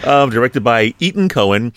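ordinary delivery

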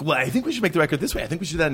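fast, high nervous chatter; nervous rambling. Retold or imitated. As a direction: rush to fill the silence.

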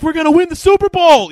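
high, loud, jubilant mock scream; screaming to his wife. Fan tells his wife about the trade, screaming excitedly. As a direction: yell it like an overjoyed fan. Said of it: "He's like screaming to his wife".

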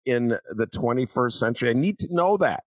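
high-pitched, incredulous rhetorical questioning; indignant disbelief. Real, not acted. Host challenging the idea that standards change, indignant. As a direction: pile up questions in rising disbelief. Indignant rebuttal of the cartoon's excuse.

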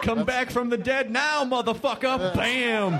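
high, put-on voice with a punched finish; hammed-up impression. Director character taunting the undead, hammed-up impression. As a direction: deliver it as a mock movie line. Acted zombie-killer line after Romero bit.

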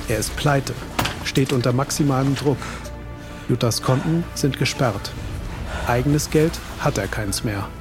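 ordinary talk

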